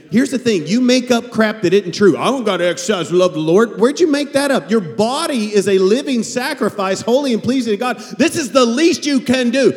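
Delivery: high, loud pulpit exhortation; full-voiced sermon push; fiery preaching. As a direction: preach it hard at the congregation.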